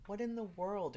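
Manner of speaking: high, incredulous cry; can't believe what she just read; stunned disbelief. Real, not acted. Incredulous repeat, much higher.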